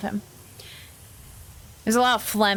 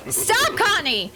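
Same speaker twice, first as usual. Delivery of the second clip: playful scolding; 10 semitones higher, slower than usual. Real, not acted. Scolding chat member.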